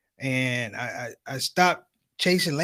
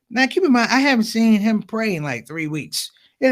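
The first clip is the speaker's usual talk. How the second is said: ordinary talk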